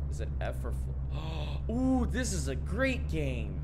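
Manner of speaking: loud, rising excited yelps; thrilled and flustered at the wheel; gasp, then gush over the game. Real, not acted. Panicked at driving in game.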